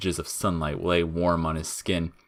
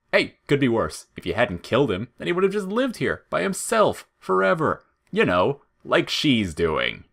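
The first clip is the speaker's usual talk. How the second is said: mock chirpiness; 8 semitones higher, 4 dB louder than usual. A narrator's reading. Flowey mocking cheerfully.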